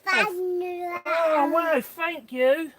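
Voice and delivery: oh wow, genuinely pleased and lifted; delighted thanks. Real, not acted. A delighted thank-you to the little helper.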